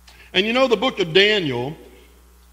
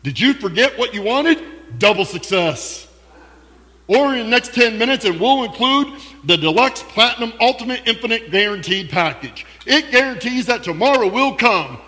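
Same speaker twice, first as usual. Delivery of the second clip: infomercial announcer; 3 semitones higher than usual. Pretend. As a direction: sell it like a late-night ad. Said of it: "It sounds like an infomercial".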